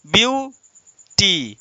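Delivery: "Beauty" slowly split into view, then टी, with a long gap; syllable by syllable. Shown as a demonstration. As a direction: say "beauty" as two drawn-out, separate syllables. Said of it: "जरा सा मेरे साथ बोलेंगे". ("Beauty" को धीरे-धीरे ब्यू... टी में तोड़कर)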